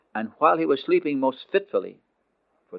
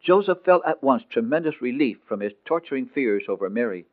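plain reading voice

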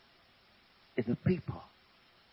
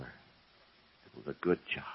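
quiet fatherly pride; 11 semitones lower, 9 dB quieter, much slower than usual. Acted.

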